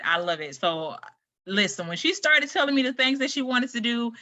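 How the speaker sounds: ordinary talk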